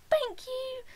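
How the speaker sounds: very high, loud thank-you; starstruck squeal. Retold or imitated. Starstruck fan thanking her idol.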